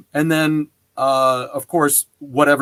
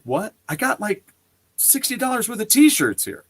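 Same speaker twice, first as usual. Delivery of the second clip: high-pitched, incredulous retelling; surprised protest; 8 semitones higher than usual. Retold or imitated. Customer protesting free T-shirts to the counter staff, surprised. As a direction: act out disbelief at a free gift.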